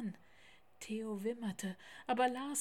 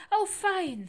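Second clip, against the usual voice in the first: high, loud happy cry; delighted; 10 semitones higher, 11 dB louder than usual. A narrator's reading. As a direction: burst out with joy. Lars delighted at the news. (hoch und freudig ausgerufen)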